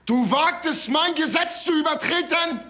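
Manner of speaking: furious tyrant. Acted. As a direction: bellow it as the outraged king. Creon confronting Antigone onstage.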